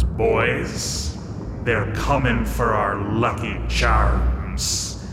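slow, low shouting pirate. Acted. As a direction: bellow it like a pirate captain. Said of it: "And the pirate goes".

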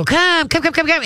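high, bright coaxing call to a dog; excited dog call. Shown as a demonstration. Trainer calling a dog on a long line, high excited coaxing. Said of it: "And you, you go".

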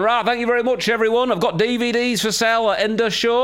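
high, lightly voiced northern singer; a club singer plugging his DVDs up north; northern accent. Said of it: "in a really northern accent".

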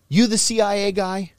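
tough guy question. Retold or imitated. Mob boss voiced in story.

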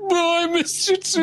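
high, hammed-up wail; blubbering high-pitched reunion cry; crying dad. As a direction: wail it like a dad in tearful pieces. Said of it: "the dad is a mess".

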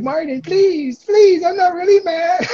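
high, slow, whiny begging that breaks into a cry; mock pleading. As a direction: beg him like a kid about to cry, hamming it up. Begging brother to calm down.

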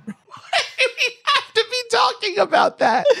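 high, squeaky voice through laughter; laughing. Real, not acted. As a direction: barely get it out while cracking up. Cracking up at ring announcer's intro.

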